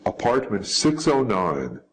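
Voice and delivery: usual speaking voice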